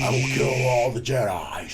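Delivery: slow, menacing bad-guy voice; imagined villain Jar Jar. Friend imagining Jar Jar as secret bad guy, slow villain voice. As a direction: draw it out like a villain dropping the act. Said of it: "drops the act and is just like".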